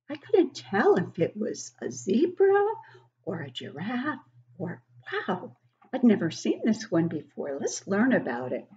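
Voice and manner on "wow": ordinary delivery, nothing marked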